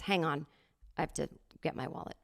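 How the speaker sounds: quiet mumbled aside. Retold or imitated. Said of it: "and says".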